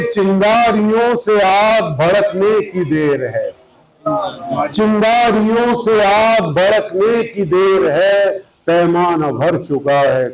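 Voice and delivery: sustained, measured Urdu verse delivery; poetry recitation. Acted. Poet offering couplets to an audience, mushaira recitation. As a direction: recite in long lines, raising the pitch on repeats.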